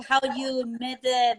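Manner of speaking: usual speaking voice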